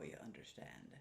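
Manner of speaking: ordinary delivery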